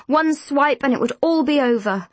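plain reading voice

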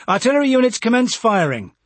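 loud, high order to fire; military command. A narrator's reading. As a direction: command the guns over the radio.

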